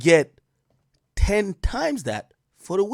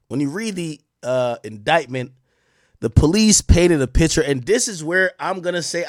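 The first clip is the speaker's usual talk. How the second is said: usual speaking voice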